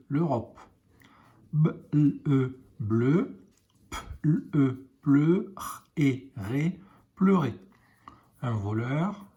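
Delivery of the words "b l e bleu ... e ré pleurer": put-on slow letter-by-letter blending for a child; sounding out letter by letter. Shown as a demonstration. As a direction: sound out each letter of sœur, then blend into sœur. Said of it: "teacher sounds out letters".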